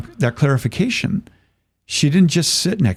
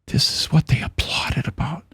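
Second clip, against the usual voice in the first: hushed whisper of realisation; voiceless, horrified aside; stunned whisper; 5 semitones higher, 4 dB quieter than usual. Retold or imitated. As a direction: whisper it to yourself, appalled. Retold horrified realisation to himself.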